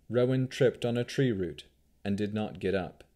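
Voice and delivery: usual speaking voice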